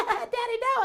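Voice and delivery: put-on very high, giggly child shriek; playful high-pitched protest; squealing chased kids. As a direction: squeal it like a kid being chased.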